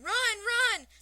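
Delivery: high, very loud cheering shout; roaring fans. A narrator's reading. Fans urging a batter on after a hit, roaring. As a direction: roar it like a ballpark crowd. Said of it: "he hears the fans roaring".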